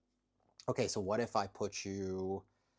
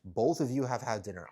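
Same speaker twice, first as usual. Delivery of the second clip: louder, firm telling-off; scolding the cats; 4 semitones higher, 7 dB louder than usual. Real, not acted. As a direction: put your foot down with the pets.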